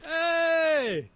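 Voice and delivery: high-pitched distant call; yelling. Acted. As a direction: holler to get their attention from afar. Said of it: "Habes start yelling".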